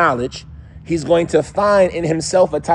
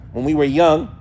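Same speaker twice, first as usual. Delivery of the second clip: ordinary talk, nothing marked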